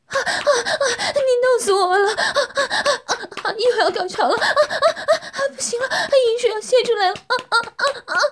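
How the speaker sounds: very high, loud climax cries with laughter. Acted. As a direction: scream out the climax. Woman reaches climax. (高潮时尖声大叫)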